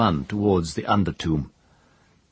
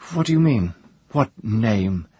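startled demand; 5 semitones higher, 4 dB louder than usual. Acted.

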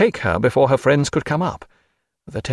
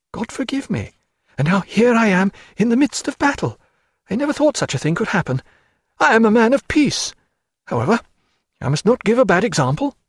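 a higher, fluttering clergyman's voice; nervous parson; 10 semitones higher than usual. Acted. Chaplain frets to Stephen about the ships, high and fluttering. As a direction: play the timid chaplain, fussing.

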